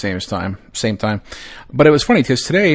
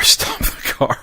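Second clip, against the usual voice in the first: breathless laughing repeat; laughing through it; 3 semitones higher, slower than usual. Retold or imitated. Dad chasing the car, dissolving into laughter. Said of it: "I'm yelling".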